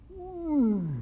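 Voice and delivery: high, loud mock roar; lion roar. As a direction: let the lion roar in triumph. Lion roars after tiger's answer.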